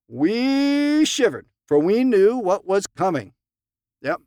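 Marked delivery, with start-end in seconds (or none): 0.1-1.5 much higher, very slow "We shivered"; drawn out shiver. A narrator's reading. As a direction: stretch it like a fearful hush. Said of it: "time came for him to speak".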